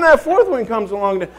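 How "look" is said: loud, high mock outburst; comic exasperation. Father reacting as the fourth one comes along, loud exasperation. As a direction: blurt it out loudly in mock dismay.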